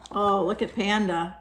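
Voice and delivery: usual speaking voice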